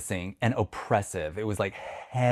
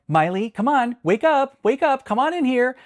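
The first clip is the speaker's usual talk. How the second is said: high, raised retold call to a dog; urgent calling; 13 semitones higher, 5 dB louder than usual. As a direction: urge the dog awake from across the room. Calling her dog to wake.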